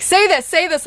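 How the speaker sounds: egging herself on, repeating the nudge; pushy inner urging. Retold or imitated. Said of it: "Patricia's like".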